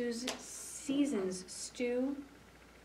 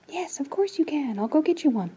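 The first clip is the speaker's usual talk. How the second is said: ordinary delivery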